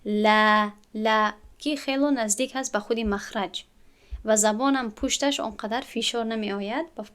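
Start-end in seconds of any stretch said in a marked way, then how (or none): 0.0-0.7 لا from the front of the mouth, thin and light, louder; light lam. Shown as a demonstration. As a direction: keep لا light and forward.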